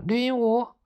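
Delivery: high-pitched hail; calling out. A narrator's reading. Maomao inviting the parrot down, calling out. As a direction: call up to someone above. 毛毛朝屋檐上喊鹦鹉.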